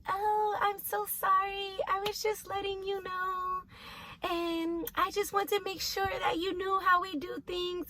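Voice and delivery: mock sweet nurse. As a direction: mimic her fake-polite backpedal. Nurse voiced apologizing in story.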